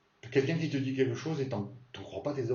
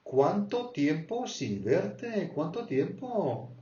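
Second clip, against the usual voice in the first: high, slow delighted greeting; happy reunion; 6 semitones higher, slower than usual. Shown as a demonstration. Greeting a long-unseen friend, slow and high delighted. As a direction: sing it out to an old friend. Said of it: "t'as pas vu quelqu'un depuis longtemps".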